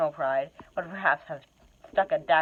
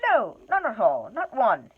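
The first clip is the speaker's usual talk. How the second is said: high, quick take-back; a scared hobbit covering his slip; hasty correction; 7 semitones higher, 5 dB louder than usual. Acted. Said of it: "he said immediately afterwards".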